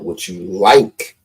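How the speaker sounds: slow, leaning hard on "like"; stress on like. Shown as a demonstration. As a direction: drag out "like" to mark it as the key word. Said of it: "the key word, underline the word like".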